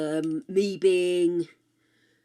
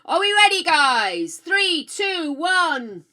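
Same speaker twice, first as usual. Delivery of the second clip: calling out countdown; 10 semitones higher, 8 dB louder, slower than usual. Real, not acted. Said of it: "let's just make sure we're connected".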